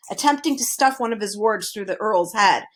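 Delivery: usual speaking voice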